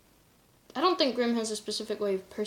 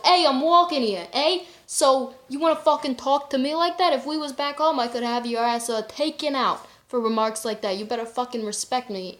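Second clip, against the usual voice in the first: put-on brash New York accent, higher and loud; 1950s New York accent; 3 semitones higher, 7 dB louder than usual. Imitating a rude New Yorker threatening her in a loud New York accent. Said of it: "How do you do a broken".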